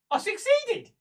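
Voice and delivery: high, loud cry of triumph; triumphant. Acted. Prisoner realising her mission worked, loud triumph. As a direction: burst out with it, pitched right up. Celebrates the target's death.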